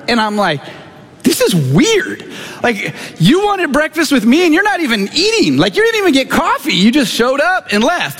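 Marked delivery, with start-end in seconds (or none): 1.2-8.2 pitched way up, baffled disbelief; incredulous. Retold or imitated. Retelling his thoughts about the man who left, high and incredulous. Baffled by a strange breakfast meeting.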